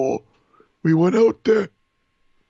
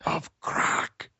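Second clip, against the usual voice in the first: whisper; 9 semitones higher, 7 dB quieter, slower than usual. Retold or imitated. Imitating a high man on a voicemail.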